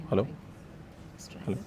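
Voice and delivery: ordinary talk, nothing marked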